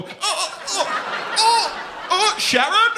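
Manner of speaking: high hammed-up moans; mock moaning. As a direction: groan it out like a posh old man. Mocking posh man climaxing.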